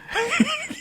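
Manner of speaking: quiet high giggle; a real soft laugh; breathy high laugh. Real, not acted. Laughing at dad's remark.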